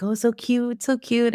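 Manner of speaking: high sweet cooing, imitating doting relatives; cooing over a baby. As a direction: gush like an aunt over a cute baby.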